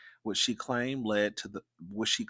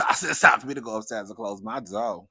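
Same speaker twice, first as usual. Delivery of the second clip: laughing retelling; 3 semitones higher, 10 dB louder than usual. Real, not acted. Amused retelling of her own exit.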